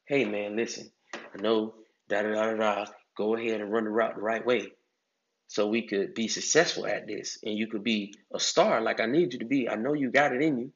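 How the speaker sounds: low, soft and smooth encouragement; smooth coaching talk. Shown as a demonstration. Coach calmly encouraging a player to run his route, low soft and smooth. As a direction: talk him through it calmly, like you believe in him. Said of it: "to smoothness".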